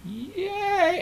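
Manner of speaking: high, thin mock cheer; tiny crowd cheer, high up; small wave cheer. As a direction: squeal a little pretend "yeah". Someone starts the wave.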